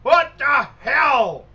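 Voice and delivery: high, loud, drawn-out shout; angry outburst. Acted. Man behind the door curses at visitors, loud angry shout. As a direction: bellow each word in exasperation. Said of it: "and then he goes".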